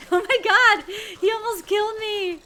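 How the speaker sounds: high, loud laughing relief; laughing panic. Real, not acted. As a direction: laugh out the near miss. Nearly killed by attacker.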